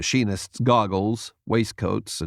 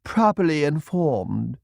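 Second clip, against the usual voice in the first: put-on slow, posh British accent; upper-class British; 5 semitones higher than usual. A narrator's reading. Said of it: "Wiz's upper-class Brit".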